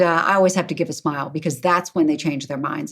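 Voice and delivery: usual speaking voice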